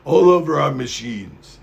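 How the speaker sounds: lightly put-on Japanese accent. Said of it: "That was my Japanese accent".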